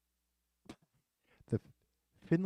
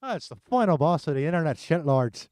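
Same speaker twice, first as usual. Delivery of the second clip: put-on loud, higher Irish accent; 4 semitones higher, 13 dB louder than usual. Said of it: "You gotta say it in Irish accent".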